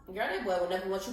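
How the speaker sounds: muttering a doubt to herself; inner voice. Retold or imitated. Girl telling herself the football player won't want her, quick and soft. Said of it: "I'm thinking to myself".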